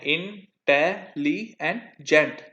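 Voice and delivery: इंटेलिजेंट drawn out very slowly, in-te-li-gent; इंटेलिजेंट sounded out syllable by syllable; split into syllables. Shown as a demonstration. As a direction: count the four syllables of इंटेलिजेंट aloud. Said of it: "इंटेलिजेंट की चार आवाजें हैं".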